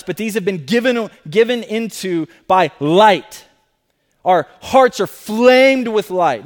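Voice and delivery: loud, ringing sermon proclamation; forceful preaching. Acted. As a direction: preach it out with full conviction. Preaching resurrection to congregation.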